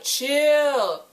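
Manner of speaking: hammed-up exasperated scolding; exasperated mock shout. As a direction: scold the fans to calm down. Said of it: "I was like".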